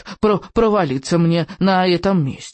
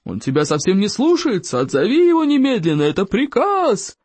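angry order; 3 semitones higher than usual. A narrator's reading. Teacher orders pupil to recall dragon, rising outrage. Учитель гневно требует отозвать дракона.

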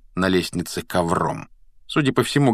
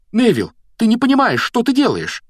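high, raised-voice appeal; agitated, high-pitched protest; exasperated pleading; 5 dB louder than usual. A narrator's reading. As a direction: implore him to see sense. Ron pleads in the quarrel.

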